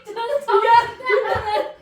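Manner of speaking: can barely talk for laughing. Real, not acted. Cracking up at the impression.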